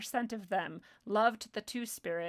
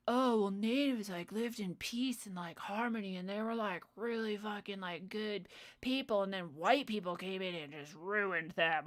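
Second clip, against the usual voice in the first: lightly voiced dimwit idealist; mocking naive believer. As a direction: imitate a naive idealist, slightly put on. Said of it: "how stupid do you have to be".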